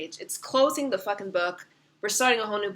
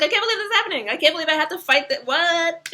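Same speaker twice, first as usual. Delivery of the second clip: high, loud, disbelieving, rushed at the end; mock shock; 8 semitones higher, 6 dB louder, faster than usual. Voicing the shocked man on the card, high and disbelieving. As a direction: play the card figure stunned at the fight. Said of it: "he's shocked".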